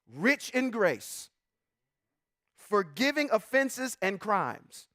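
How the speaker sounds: slow, weighty reading. Shown as a demonstration. Pastor reading scripture slowly to the congregation. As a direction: let each phrase land slowly. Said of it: "I just wanna read this slow".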